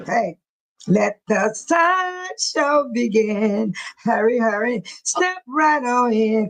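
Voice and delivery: slow, drawn-out sideshow barker call; hammed-up carnival barker welcoming the crowd. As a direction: hawk the sideshow like a circus caller. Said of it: "That's what I thought of, like, Father Sparks".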